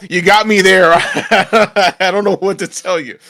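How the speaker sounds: laughing and slow. Real, not acted. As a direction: giggle through the words. Laughing at friend.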